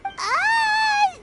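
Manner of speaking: high, drawn-out baffled whine. Acted. Companion lost by the shrine maiden's explanation, baffled whine. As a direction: whine in total confusion.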